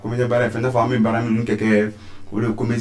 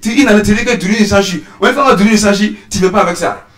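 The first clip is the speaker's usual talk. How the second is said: heated comic rant; 8 semitones higher, 6 dB louder than usual. Acted. Sketch climax, high loud voice.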